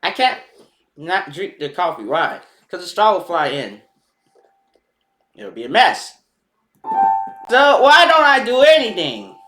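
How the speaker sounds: ordinary delivery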